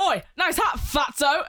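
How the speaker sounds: Australian heckle, hammed-up yell; mock Australian insult. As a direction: shout it like a cheeky Australian goth. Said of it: "this goth Australian was like".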